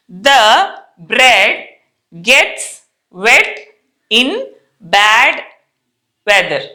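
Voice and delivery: slow, word-by-word sentence for repeating; slow model reading. Shown as a demonstration. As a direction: spell out each word slowly for the class. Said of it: "first నేను చదువుతాను వినండి".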